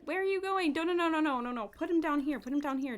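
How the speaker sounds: high, loud string of urgent protest; flustered protest. Real, not acted. Player to game character carrying puppy, flustered protest. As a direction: cut in fast and high to stop them.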